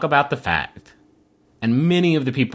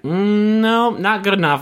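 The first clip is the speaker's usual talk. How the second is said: skeptical sister; 8 semitones higher, 3 dB louder than usual. Retold or imitated. Sister grilling Ben, acted quote.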